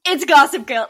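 hammed-up host intro; segment announcer. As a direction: announce the segment title with fanfare. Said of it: "new segment on the show".

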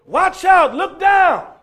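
loud high shout of alarm; shouted warning. Retold or imitated. Warning Olivia of danger, a loud high shout. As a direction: bark the warning so she looks down.

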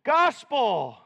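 usual speaking voice

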